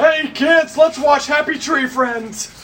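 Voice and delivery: high, loud, slow cheery host call; hammed-up children's presenter; kids show host.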